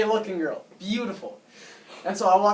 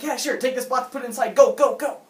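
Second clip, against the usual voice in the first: bossy neighbor; 6 semitones higher, 6 dB louder than usual. Retold or imitated. Bossy neighbor ordering him around.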